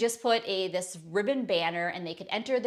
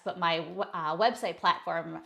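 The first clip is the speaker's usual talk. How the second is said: ordinary delivery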